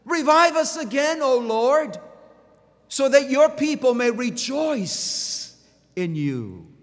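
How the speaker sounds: high, loud pleading cry, slowing at the end; psalmist's cry. Acted. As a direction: cry out to the Lord for revival. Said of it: "He cries out".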